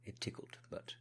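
put-on hesitant apology in a British accent; British servant excusing a ticklish laugh. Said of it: "put on a British accent".

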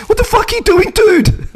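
squeaky, alarmed outburst while being grabbed; panicked yelp. Retold or imitated. Grabbed on his bike, yelps at attacker in panic. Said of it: "all that came out of my mouth was".

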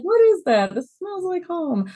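high, hammed-up puzzled delight; high-pitched mocking quote; mock wondering. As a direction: put on a high, singsong imitation. Mimics buyers sniffing, joking.

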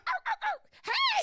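very high yelps flipping to a bright loud hey; yelp, then switch to glee; high dog yelp turning happy. Retold or imitated. Imitating her dog yelping then suddenly happy, very high and loud.

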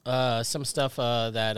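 ordinary talk, nothing marked

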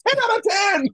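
very high-pitched mock scoring; mock judge's cheer. Scoring the gymnast's fatal landing, mock judge's cheer. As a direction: call out the score in a squeaky high voice.

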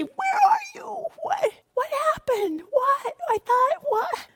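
very high, frantic cry, dropping to a whisper; a parent frightened for their child; panicked parent. Retold or imitated. Parent crying out to a child in trouble, frantic high alarm. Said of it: "cried out to one of your own kids who's in trouble".

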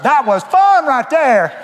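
high, loud and slow, drawn-out holler; hollered whoop. Pretend. Preacher picturing his battered arrival in heaven, slow high holler. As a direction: holler it out like a man crashing over the finish. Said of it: "and holler out".

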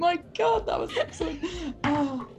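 high, breathless laughter between words; laughing. Real, not acted. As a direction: crack up while talking. Player cracking up at scene.

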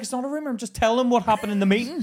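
high, loud defensive reply; heated retort. Retold or imitated. Employee arguing back at CEO, loud and high. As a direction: push back hard. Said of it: "I was like".

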